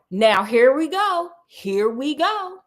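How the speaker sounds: slowed, drawn-out here we go; slow suspense. Acted. Teasing the next twist to viewers, slow and suspenseful. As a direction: drag it out to build suspense.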